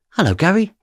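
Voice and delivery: a hint of the polished detective; lightly voiced smooth officer. A well-dressed detective greeting him in the cells, lightly voiced.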